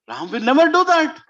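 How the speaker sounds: loud, high-pitched rebuttal; emphatic denial. Acted. Kaikeyi defends Ram to Mantra, emphatic denial. Acts queen rejecting the warning.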